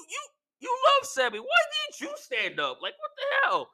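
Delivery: very high, squeaky challenge; mock incredulous protest. Fan challenging Jimmy for staying seated, high squeaky protest. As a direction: squeal it in baffled reproach.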